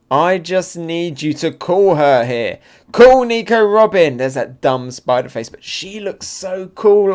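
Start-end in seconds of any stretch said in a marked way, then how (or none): 0.1-4.2 louder, slower acted demand building high; villain's demand. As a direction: press the demand, rising to a loud peak.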